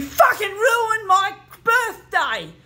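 high, loud, explosive outburst; furious yelling. Real, not acted. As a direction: scream it at them in real rage. Furious end of rant.

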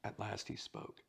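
usual speaking voice

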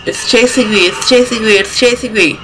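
desperate as the monster closes in; panicked. Real, not acted. Being chased in horror game.

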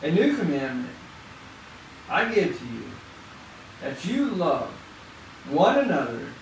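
solemn pulpit reading of John; scripture reading. Acted. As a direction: read the verse reverently, word by word. Reads scripture aloud slowly.

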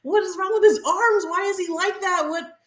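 high-pitched mock freak-out; retold screaming. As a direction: shriek in horrified disbelief, reenacting a reaction.

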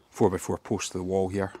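usual speaking voice

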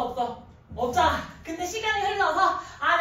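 ordinary talk, nothing marked

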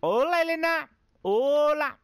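loud high hello; excited greeting. Real, not acted. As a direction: call out the hello brightly.

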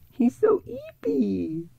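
very high, slow cooing voice; baby talk to cat. Acted. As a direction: coo at the sleeping cat like a baby.

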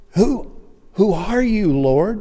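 high, loud, halting question; startled Saul. Acted. Saul answering the voice from heaven, high and startled. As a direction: stammer the question upward in alarm. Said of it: "Paul, or Saul says".

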